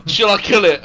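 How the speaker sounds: loud, high-pitched alarmed shout; screamed warning. Acted. Player warning the party about a vampire, mock scream. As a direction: scream it out to your companions. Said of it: "I'm gonna scream out".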